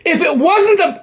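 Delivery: normal talking voice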